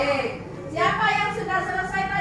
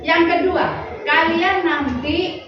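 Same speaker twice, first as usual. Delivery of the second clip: formal announcement; 4 semitones lower, 6 dB louder than usual. Real, not acted. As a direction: announce the lesson goals to the class. Teacher states lesson goals. (pengumuman resmi guru, lebih keras dan pelan)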